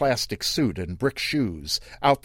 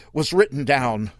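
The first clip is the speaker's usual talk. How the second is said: plain reading voice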